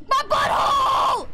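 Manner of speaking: high, loud outcry; comic high-pitched wail; yelping shout. Real, not acted. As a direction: yell it as if suddenly stabbed from behind. Hit from behind in game.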